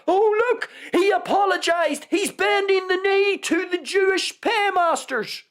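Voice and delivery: very high, gleefully accusing mock voice; mocking online critics. Podcaster mimicking internet critics' accusation, high mocking voice.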